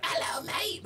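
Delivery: breathy whispered hello mate; whispered greeting. Retold or imitated. Host imitating Patrick's greeting in a whisper.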